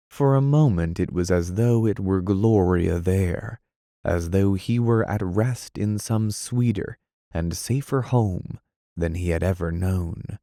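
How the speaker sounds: ordinary delivery, nothing marked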